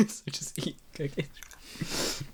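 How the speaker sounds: slow, breathy whisper through a laugh. Real, not acted. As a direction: whisper it slowly while giggling. Laughing trail-off while eating.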